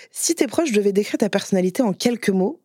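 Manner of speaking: usual speaking voice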